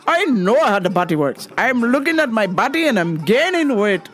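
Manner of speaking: put-on high, indignant Indian-accented voice; rude Indian lady. Customer demanding help with her weight, rude Indian lady voice. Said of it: "a rude Indian lady".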